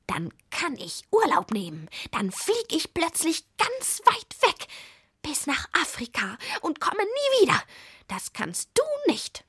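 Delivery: high-pitched spiteful boasting; peevish, taunting witch; venomous. A narrator's reading. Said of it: "sagte die Zwickurlaub giftig".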